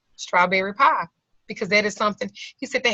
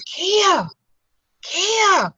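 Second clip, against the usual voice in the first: high, drawn-out call from another room; calling her name; 10 semitones higher, 3 dB louder than usual. Retold or imitated. Dying father calling his daughter's name from his bed. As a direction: call the name out long and high, as if through a cave.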